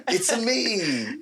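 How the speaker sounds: high, slow cartoon Italian accent; drawn-out catchphrase; Mario impression.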